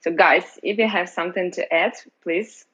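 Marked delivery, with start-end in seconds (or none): none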